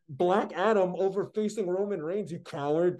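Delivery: high, loud exasperated rant; mock outrage. Real, not acted. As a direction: blast him like a betrayed fan. Ranting at The Rock's choices.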